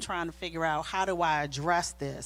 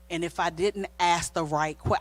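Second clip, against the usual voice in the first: ordinary delivery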